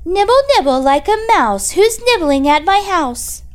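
put-on high, loud old witch calling out; shrill-sounding witch voice from inside. A narrator's reading. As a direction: croak it out like a witch at her door. Witch calls from the house.

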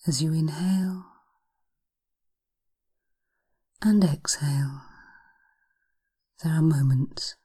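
slow, hushed hypnosis voice; breathy guided relaxation, unhurried. Acted. Hypnosis session guiding relaxation.

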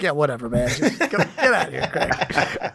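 mock dismissive friend. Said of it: "And Colin was like".

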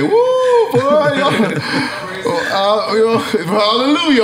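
a retold hype whoop; hyped-up holler. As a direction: whoop it up like church. Retelling his own hype shout.

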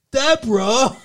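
badly faked sitcom husband calling; failed Ray voice. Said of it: "I can't do, I fucking can't do it".

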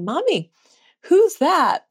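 put-on curious little boy. Son asking his mother.

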